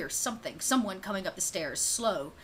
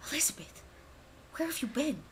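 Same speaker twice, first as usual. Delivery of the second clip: whisper; 4 semitones higher, 9 dB quieter, faster than usual. Acted. Butler hushes after the hug.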